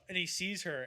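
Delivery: ordinary talk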